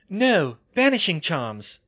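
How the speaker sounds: high, loud, slow command; ordering Hermione. A narrator's reading. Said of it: "Hermione ordered".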